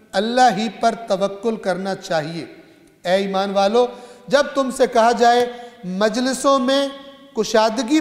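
emphatic sermon delivery with drawn-out key words; preaching recitation. Acted. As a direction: proclaim the verse firmly to the congregation. Recites scripture translation in sermon.

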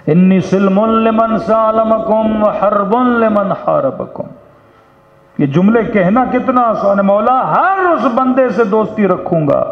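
high, ringing declamation of a sacred pledge; impassioned preaching. Acted. Preacher vowing loyalty to Maula before the congregation, impassioned.

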